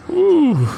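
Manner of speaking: squealed high ooh; a hot, pained high ooh. A narrator's reading. Quentin melting in the heat, high squealing ooh. Sweating in the blazing sun.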